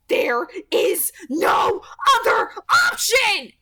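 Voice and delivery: loud, slow, high whispered snarl; blistering snarl. A narrator's reading. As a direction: hiss it out in blazing fury. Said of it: "her voice a blistering snarl".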